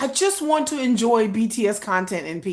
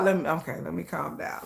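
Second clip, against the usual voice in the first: calming down; 10 semitones lower, 11 dB quieter than usual. Real, not acted. Calming herself after outburst.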